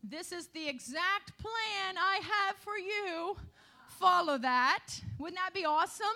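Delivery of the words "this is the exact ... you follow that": slow, weighty voice of God speaking a personal command; lightly voiced divine instruction, building louder at the end. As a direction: speak as God giving a clear plan, ending firm and loud. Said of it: "it's not that audible voice".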